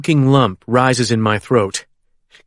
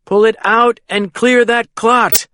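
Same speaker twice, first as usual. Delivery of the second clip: high, slow, emphatic command; urgent cry; 10 semitones higher, 3 dB louder than usual. A narrator's reading. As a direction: drive each word home in a raised voice. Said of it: "he cried".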